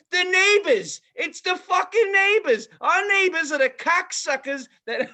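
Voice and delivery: high, loud mock rant about dog mess; ranting phone call. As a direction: imitate the owner ranting on the phone. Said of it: "she's like".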